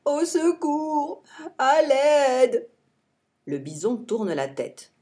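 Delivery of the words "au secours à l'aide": drawn-out mock wail, high and slow; fake cries for help. Jean-Claude fakes an injury to lure the bison, mock wail. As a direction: pretend to be hurt, hammed-up. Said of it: "se met à gémir, comme s'il était blessé".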